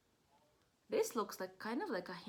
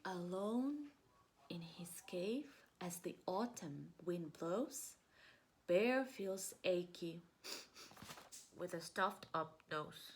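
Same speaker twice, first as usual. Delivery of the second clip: hushed story reading; 3 semitones lower, slower than usual. A narrator's reading. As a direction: draw the children in with a hushed telling.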